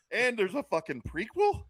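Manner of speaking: high-pitched outrage; incredulous. Real, not acted. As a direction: still can't believe it. Renewed disbelief at prequel.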